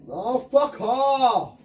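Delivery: slow, loud, high furious yell; angry outburst. Real, not acted. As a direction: let the rage out, drawn out. Raging at game.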